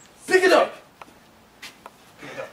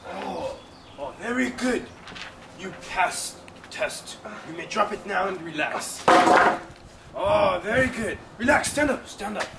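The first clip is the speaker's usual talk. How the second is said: soft approving; 4 semitones lower, 7 dB quieter than usual. Acted. Guard praising quietly.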